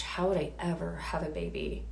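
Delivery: hushed worried thought; a soft, worried inner whisper; anxious whisper. Retold or imitated. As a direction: whisper your fear to yourself.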